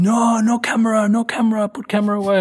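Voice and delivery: high, loud foreign guard's order; mock frantic ban in broken English; alarmed guard. Guard jumping out at a tourist with a camera, alarmed. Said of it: "this guy jumps, like, out of the building".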